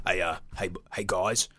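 low, soft voice; dropped low and subdued, slightly rushed at the end; quiet, low-pitched impression; character impression. Big Lez impression on request.

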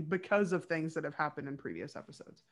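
ordinary talk

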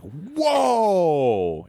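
long high drawn-out whoa; the class reacting to the teacher snapping; shocked whoa. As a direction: stretch a stunned whoa up high. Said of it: "it was like".

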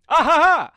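wild high "ah-ha-ha" yell; high loud yell-laugh. Acted. Kid yelling along with Jelly Belly, a high loud whoop.

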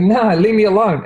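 put-on high, pushy little-boy voice pushing the helper away; a small kid snapping at a grown-up to back off; bratty little kid. Kid refusing help, voiced.

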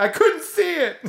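high, loud pretend outcry; mock panicked yell. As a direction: yell it like an overdramatic driver. Acting out drivers listening in.

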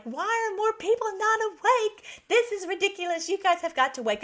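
high, frustrated wake-up call; exasperated outburst. Retold or imitated. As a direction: throw up your hands at everyone. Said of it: "We're like".

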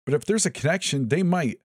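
high, urgent pushback. A narrator's reading. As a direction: insist over him. Jonathan arguing.

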